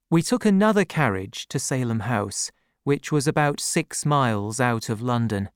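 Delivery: usual speaking voice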